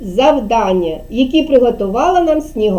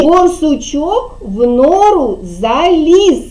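slow, clear tongue twister; slow modelled phrase; slower than usual. Shown as a demonstration. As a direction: say it slowly so kids can repeat. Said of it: "Повторюйте за мною". (повільно й чітко, як зразок для дітей)

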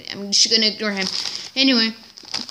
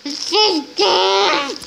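drawn-out delight; 9 semitones higher, much slower than usual. Pretend. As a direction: stretch it out like it's delicious. Savouring the food.

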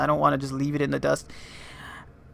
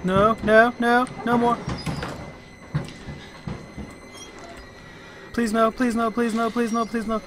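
frantic high pleading; anguished denial; 7 semitones higher than usual. Real, not acted. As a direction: beg desperately, voice raised. Pleading as monster approaches.

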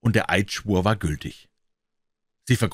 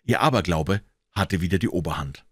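ordinary delivery

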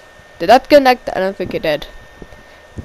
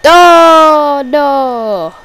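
dismayed cry; 3 semitones higher, 8 dB louder than usual. Real, not acted. Commentator watching the match turn, dismayed cry. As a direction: cry out in dismay, letting it sink.

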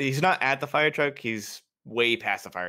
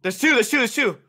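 sharp, high urgent shout; urgent callout; 13 semitones higher, 6 dB louder than usual. Real, not acted. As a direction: call it out fast to the team. Spotting enemies mid-firefight.

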